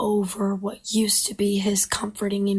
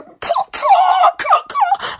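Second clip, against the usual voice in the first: much higher, louder caws; vulture cawing; 10 dB louder, slower than usual. Acted. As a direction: screech caw caw like a vulture. Vulture cries cut in.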